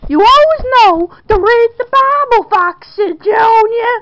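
angry yell. Acted. Mother punishing her son, loud angry scolding. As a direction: yell at the boy as you punish him.

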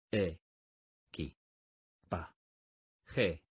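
"E. Qui. Pa. G." each syllable spoken apart with a pause. Shown as a demonstration. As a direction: split "Equipaje" into separate syllables. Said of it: "dila silábicamente conmigo". (separando sílabas: "E. Qui. Pa. Je.")

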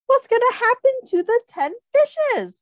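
high, loud anxious question; worried suspense. Acted. Reader to children, high worried suspense. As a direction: build the suspense for the kids.

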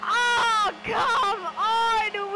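loud, high frustrated cry; real cry of frustration; exasperated yell. Real, not acted. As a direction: protest loudly in dismay. Opponent has just scored.

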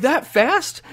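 high amazed question; surprised. Retold or imitated. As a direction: ask it in delighted shock. Shocked at quick shiny.

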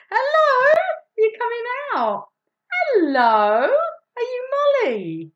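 high, loud thrilled hello; high, loud greeting to the puppet Molly; excited greeting. Acted. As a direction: greet the new puppet with delight.

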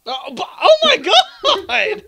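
high, loud, slow laughing shock; laughing shock at a friend's slip. Real, not acted. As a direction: gasp out in laughing disbelief.

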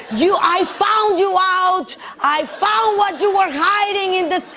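high, far-off mock accusing husband voice; shrill-free but high acted outburst, hammed up. Wife retelling husband yelling about the hidden animal, mock outraged.

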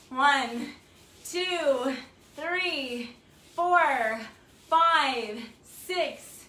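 loud, bright rep count with upward lifts; energetic workout countdown; coach counting reps. Acted. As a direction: call the count out like a fitness coach.

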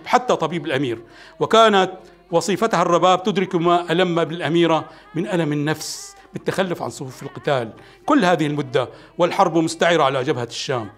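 ordinary delivery

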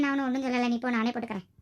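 normal talking voice

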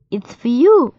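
excited. A narrator's reading. Molly offers apple.

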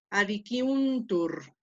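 adiciuntur with the syllable un drawn long; lengthened syllable. Shown as a demonstration. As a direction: stretch the un in adiciuntur. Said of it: "ahí hicimos el acento". (adiciuntur con la sílaba un alargada)

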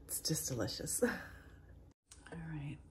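soft hushed whisper. Real, not acted. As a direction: whisper so no one wakes. Whispering in the Airbnb.

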